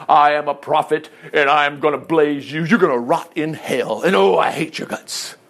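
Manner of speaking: hammed-up condemning prophet, low in pitch; mock hellfire prophet. Preacher parodying what Daniel is not, mock hellfire prophet. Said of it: "look at Daniel's attitude here. Daniel's not".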